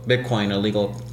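ordinary talk, nothing marked

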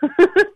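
bursting laugh. Real, not acted. Telling a friend about the dream dog food, high burst of laughter. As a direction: laugh out at the absurdity.

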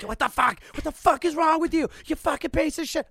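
high, loud road-rage yelling; swearing tirade. Retold or imitated. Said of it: "I'm just like".

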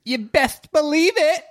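high, slow put-on squeak; squeaky catchphrase. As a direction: squeak it out like a cartoon.

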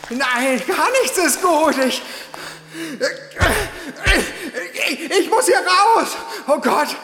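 a man hyperventilating in panic; breathless panic; panicky gasping. Acted. Panic attack, can't breathe.

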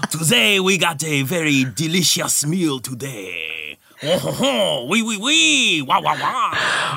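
slow cartoon French waiter accent. Auditioning on a dare, a slow campy French accent. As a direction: linger over it like a campy French chef. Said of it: "at least audition for the part?".

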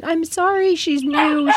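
high, hurried apology; anxious high sorry; flustered apology. Retold or imitated. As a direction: owner apologizing to the ladies. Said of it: "I just kept saying".

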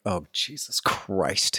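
slow, softened mock dread; hushed dismay. Walking into the strip club, slow hushed dismay. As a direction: mutter it slowly, appalled.

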